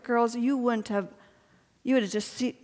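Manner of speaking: ordinary talk, nothing marked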